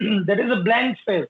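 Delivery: slow, deliberate reading; slow dictation. Shown as a demonstration. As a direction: dictate for notes. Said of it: "dictating notes".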